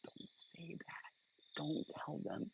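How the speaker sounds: barely audible secret warning; hushed whisper. Acted. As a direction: whisper it very slowly, under the breath. Secretive aside to friend in public.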